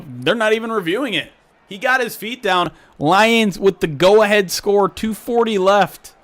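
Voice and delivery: high, loud sportscaster call; excited play-by-play. Acted. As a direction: call the replay like live TV. Calls the go-ahead score.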